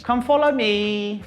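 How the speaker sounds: usual speaking voice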